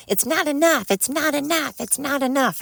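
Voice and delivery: high mocking whine of the greedy; mocking whiny takers. Mocking the freeloaders to her viewers, high whine. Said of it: "they're still like".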